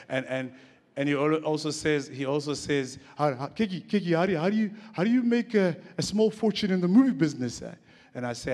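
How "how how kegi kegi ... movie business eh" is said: Ronnie Aptieka. Retold or imitated. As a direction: mimic a friend setting up a joke in thick accent. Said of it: "he speaks in a strong accent".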